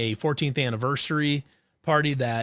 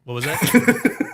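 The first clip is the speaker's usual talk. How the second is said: laughing; 3 semitones higher, 6 dB louder, much slower than usual. Real, not acted. Laughing at a friend's remark.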